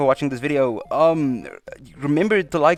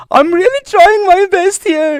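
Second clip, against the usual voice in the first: very high, loud squealed plea; exasperated wail; 10 dB louder than usual. Acted. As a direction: wail it in comic desperation, voice way up high. Exasperated plea after botching a name.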